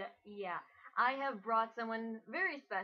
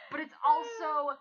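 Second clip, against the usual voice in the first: loud, higher exasperated cry; exasperated shout; 4 semitones higher, 8 dB louder than usual. Real, not acted. Host ranting to her cousin about the art, shouting. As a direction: throw it out in disbelief.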